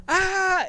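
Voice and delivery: high, loud cry of pain; the friend crying out after shooting his toe; imitated pained yell. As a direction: yelp like you just shot your toe. Friend shot his own toe.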